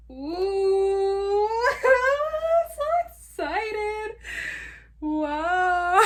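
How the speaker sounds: high, loud, slow squeal near tears; overjoyed squeal. Real, not acted. Opening her new laptop, loud high squeal of joy. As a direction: squeal with joy almost crying.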